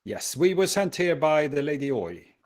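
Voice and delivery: ordinary talk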